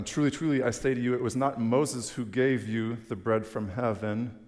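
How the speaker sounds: a hint of preaching force; scripture reading. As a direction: proclaim the words like a preacher. Reading Jesus's words.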